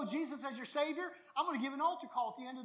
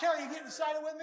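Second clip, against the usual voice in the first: loud shout to the congregation; rally her from the pulpit; fast shouted question; calling out; 4 semitones higher, 6 dB louder, faster than usual. Real, not acted.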